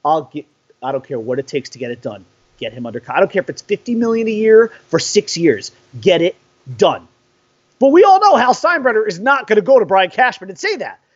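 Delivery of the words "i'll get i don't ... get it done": imitating the team owner. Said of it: "went to Cashman and said".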